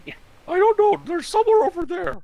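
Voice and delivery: put-on high falsetto old fortune teller; squeaky wise-woman voice.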